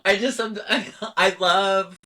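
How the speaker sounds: giggling through the words; can't stop giggling, delighted; giggly. Real, not acted. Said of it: "I couldn't stop giggling".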